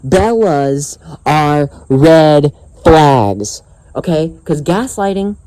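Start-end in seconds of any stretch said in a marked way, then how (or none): 0.1-3.6 very slow, each word set apart; word-by-word emphasis. Real, not acted. Vlogger hammering home his warning, very slow and emphatic. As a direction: stretch "Bellas are red flags" word by word. Repeats slogan even more slowly.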